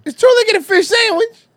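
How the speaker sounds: put-on high, fast quoted line from an old clip; high-pitched throwback meme quote; mock clip voice. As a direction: squeak it out like the meme. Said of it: "throwback one".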